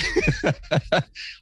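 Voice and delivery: a bright burst of laughter; real laugh; high laugh. Real, not acted. Laughs at own joke.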